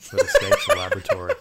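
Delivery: real laughter breaking through the words; laughing. Real, not acted. Co-host describing a smart-dog novel to his friend, laughing. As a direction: crack up mid-sentence, voice shooting high and loud.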